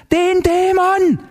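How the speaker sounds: very high, drawn-out outcry; frantic cry. A narrator's reading. Zinzendorfer answering Heller's question, frantic cry. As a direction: stretch it into a high hysterical cry. Frantic neighbour names the demon.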